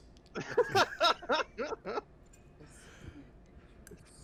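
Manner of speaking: breathless wheezing laugh; whispered laughter. Real, not acted. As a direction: silent shaking laugh. Player cracks up at scene.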